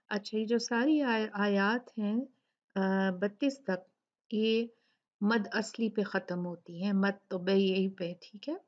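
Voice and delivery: ordinary talk, nothing marked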